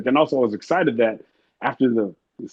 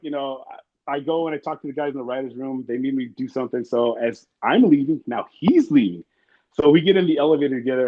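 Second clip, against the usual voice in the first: ordinary delivery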